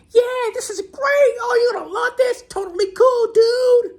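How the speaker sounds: very high, loud surfer-dude cheer; stoked hippie dude. Retold or imitated. Hippie instructor hyping the jumper mid-fall, in a high shouted dude voice. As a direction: ham up the laid-back hippie yelling with joy. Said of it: "And he's going".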